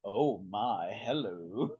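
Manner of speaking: low, drawn-out, suggestive "oh my, hello"; Sulu's suggestive hello. Retold or imitated. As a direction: purr a flirty greeting in a low voice. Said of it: "the man walks by and goes".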